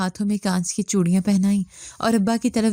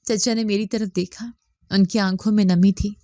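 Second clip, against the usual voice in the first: ordinary delivery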